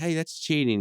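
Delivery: high indignant protest. Retold or imitated. Characters protest cheating.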